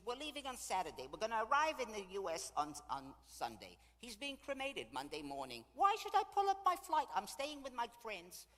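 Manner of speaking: high, loud, argumentative rattling-off of plans; indignant protest. Retold or imitated. Widow justifying staying on tour with friends, indignant. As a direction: imitate a widow outraged at the suggestion. Widow argues against flying home early.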